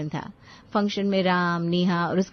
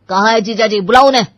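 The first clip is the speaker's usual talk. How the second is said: loud, heated summons; indignant, forceful order; angry demand; 3 semitones higher, 11 dB louder, faster than usual. Acted. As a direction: demand it, building to a shout. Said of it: "उसका गुस्सा और भी बढ़ गया".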